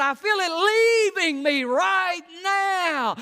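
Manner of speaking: high, loud, slow cry of release; joyful shout. Retold or imitated. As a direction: shout it as the burden lifts. Man feels deliverance.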